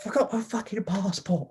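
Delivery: very high-pitched mock child voice; seven-year-old kid. Replaying the seven-year-old's punchline, mock high kid voice. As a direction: squeak it out like an indignant little boy. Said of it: "This little seven-year-old got".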